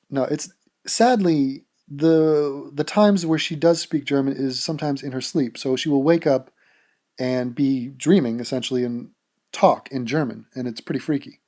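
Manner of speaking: usual speaking voice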